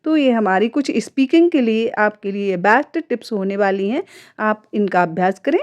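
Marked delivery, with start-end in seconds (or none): none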